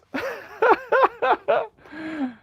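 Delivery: very high, loud cackling giggle; real high-pitched victory laugh; gleeful laugh. Real, not acted. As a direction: burst into a long high laugh at winning. Laughs after taking the game.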